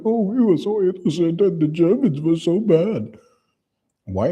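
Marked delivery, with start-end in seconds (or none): 0.1-3.2 high-pitched, hammed-up lament; mock victim voice. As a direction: imitate self-pitying pleading, pitched way up. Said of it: "and you were like".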